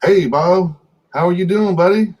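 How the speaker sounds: usual speaking voice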